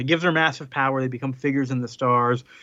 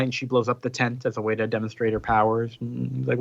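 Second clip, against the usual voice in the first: ordinary talk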